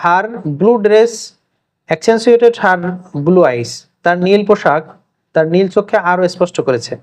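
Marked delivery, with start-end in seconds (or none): 0.0-3.7 slow dictation. Shown as a demonstration. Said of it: "এখানে একটা বাক্য দেয়া আছে".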